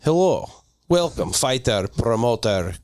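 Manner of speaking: put-on Russian accent, sinking low. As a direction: welcome them in a deepening Russian accent. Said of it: "start with Russian".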